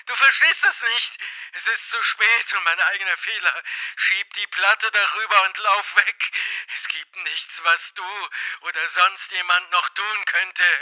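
high, thin-volumed desperate plea; despairing. A narrator's reading. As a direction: plead hopelessly in a high, soft voice. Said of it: "in einen Schrei der Verzweiflung". (verzweifelt, hoch und leise flehend)

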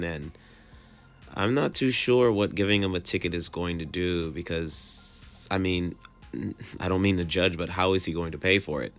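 ordinary talk

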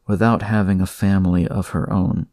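usual speaking voice

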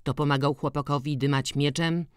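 plain reading voice

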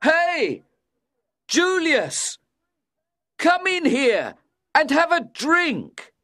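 very high, loud call down a corridor; loud drunken shout. A narrator's reading. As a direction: holler for a drinking companion. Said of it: "began to shout loudly".

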